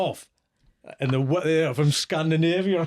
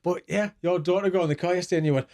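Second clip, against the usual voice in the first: normal talking voice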